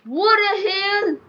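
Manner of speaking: high, slow, drawn-out disbelief; stunned outburst. Real, not acted. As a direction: stretch it out in shock. Sudden shock at game event.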